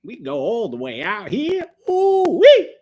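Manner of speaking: mock Southern accent with a loud high whoop; Southern twang. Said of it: "was that a Southern twang".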